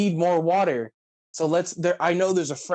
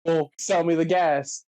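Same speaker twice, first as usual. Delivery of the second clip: higher, whiny indignant quote; mock indignant; 5 semitones higher than usual. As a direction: protest like the wronged buyer. Mocking a character.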